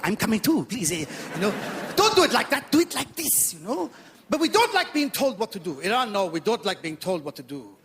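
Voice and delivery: put-on comic Iranian accent, eager to please. Comedian playing Iranian immigrant to the audience, put-on Iranian accent. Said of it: "a bit of an accent I do at the beginning".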